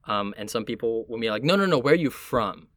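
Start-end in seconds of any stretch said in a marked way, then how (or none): none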